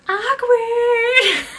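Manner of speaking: high, loud laughing shout; mimicking Bree's awkward. Friends mimicking Bree's blurt, laughing high shout. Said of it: "all three of us just mimicking Bree".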